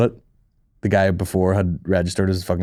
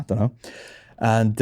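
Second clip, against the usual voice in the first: ordinary talk, nothing marked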